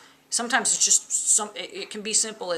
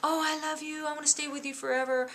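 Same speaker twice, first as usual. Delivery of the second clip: put-on higher, sweet, gushing girlfriend voice, a little fast; mock lovestruck girlfriend; 9 semitones higher than usual. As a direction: imitate a fickle girlfriend swearing eternal love. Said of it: "these girls that say".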